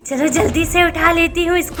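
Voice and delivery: low, sly witch muttering to herself; a lightly voiced sneaky witch stealing a child. Witch sneaking off with the sleeping child, low and sly. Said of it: "इस चुड़ैल सोनम को कहाँ लेकर".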